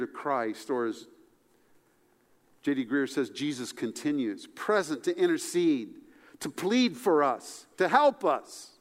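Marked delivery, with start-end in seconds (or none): none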